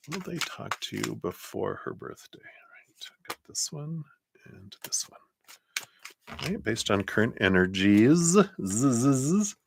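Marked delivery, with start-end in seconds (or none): 0.1-5.2 very soft, half-whispered self-talk; murmuring to self. Real, not acted. Tarot reader drawing cards to herself, hushed murmur. 8.2-9.6 buzzed zzz trailing off a word; playful buzzing. Real, not acted. Reader riffing on the end of energies, playful buzz.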